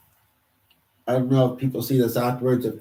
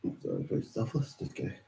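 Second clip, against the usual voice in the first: mocking aside; 13 dB quieter than usual. Real, not acted. Self-mocking aside to viewers.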